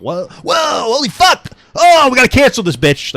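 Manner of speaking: high, loud mocking shout at the offender; mock outraged cancel mob. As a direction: ham up a shocked crowd yelling to cancel her. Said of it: "that's what the energy".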